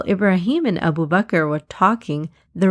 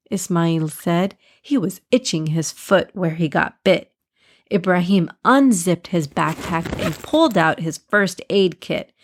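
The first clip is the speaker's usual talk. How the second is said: ordinary delivery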